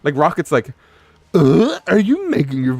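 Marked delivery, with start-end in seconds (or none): 1.3-2.7 high, louder disbelieving Rocket voice; baffled Rocket. Retold or imitated. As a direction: squeak out your disbelief as Rocket. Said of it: "he's so baffled".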